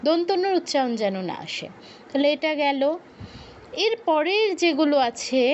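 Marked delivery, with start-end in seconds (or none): none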